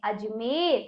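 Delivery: low, soft, unbroken Ajmer; joined-up reading. Shown as a demonstration. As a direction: say Ajmer quietly in one piece. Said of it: "तो इस प्रकार हमने इसको पढ़ा".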